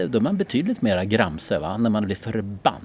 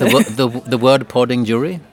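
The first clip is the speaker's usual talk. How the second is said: mock contest host; 3 semitones higher, 8 dB louder than usual. Co-host jokes they are now a voting jury, mock announcer.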